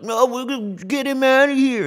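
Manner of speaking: high, loud mocking studio exec; high loud mock voice of fans banishing a director; mock outraged studio. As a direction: play Disney kicking him out, squeaky and huffy. Mock Disney voice, quoted.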